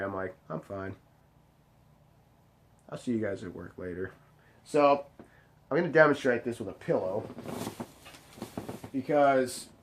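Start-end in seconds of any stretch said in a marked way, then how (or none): 0.5-4.1 much lower and softer, tight seething reply; seething quiet. Retold or imitated. Telling worried coworkers he's fine while seething. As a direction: hold the fury in, barely speak.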